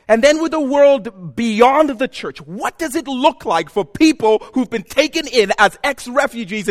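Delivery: loud, high, emphatic preaching; impassioned preaching. Real, not acted. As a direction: preach it at full force, hammering key words. Preacher builds to full force.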